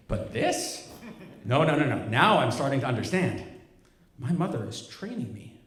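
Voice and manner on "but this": laughing disbelief. Real, not acted.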